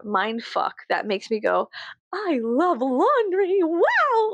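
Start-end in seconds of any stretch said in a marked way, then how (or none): 2.1-4.3 high, slow dreamy glee; dazed, blissful high voice; brainwashed cheer. Pretend. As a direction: sing it like a hypnotized fan of chores. Brainwashed happy voice.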